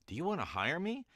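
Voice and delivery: playful high-pitched ask. Shown as a demonstration. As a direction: lift the whole line up high. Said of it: "four different tones on that".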